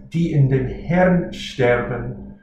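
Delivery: die in dem Herrn sterben, very slow; careful German reading. Shown as a demonstration. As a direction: spell out each German word slowly. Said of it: "Then the next phrase".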